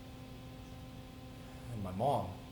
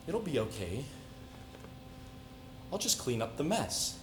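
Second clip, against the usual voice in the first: soft, softer, eerily calm reassurance; quiet, controlled false comfort; forced calm; 10 dB quieter than usual. Retold or imitated. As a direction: hold the rage under a soothing voice. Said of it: "with the calmest voice I could muster".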